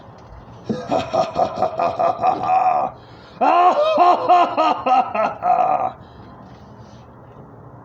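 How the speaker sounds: loud, long put-on character laugh; impression of a laugh. Shown as a demonstration. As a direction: belt out a cartoonish laugh. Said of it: "doing the impressions of a laugh".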